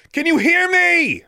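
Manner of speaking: high, long-drawn shout; yelled. A narrator's reading. Heroine calls to girl fallen off the cliff, yelling. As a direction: holler it slowly so it carries far. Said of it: "Alexandra yelled".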